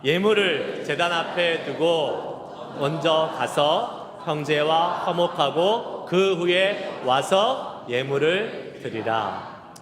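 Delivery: slow, loud scripture recitation led line by line. Shown as a demonstration. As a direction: recite it slowly and loudly, as a congregation. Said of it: "시작". (천천히 크게 암송)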